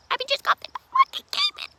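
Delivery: extremely high, squeaky announcement; retold squeal. Student retells informing an absent friend, very high squeal. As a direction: squeal the news out, far above your voice.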